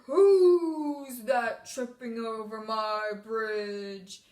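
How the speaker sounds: loud, low, very slow troll; best troll voice. Shown as a demonstration. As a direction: boom it out slowly like a storybook troll. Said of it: "We used our best troll voice".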